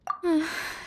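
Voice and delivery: soft, defeated sigh; resigned sigh. Acted. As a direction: let the air out in resignation. Resigned to renting the place.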